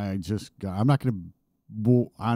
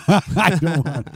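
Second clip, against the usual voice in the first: laughing; 5 semitones higher, 3 dB louder, much slower than usual. Real, not acted. Protesting being volunteered.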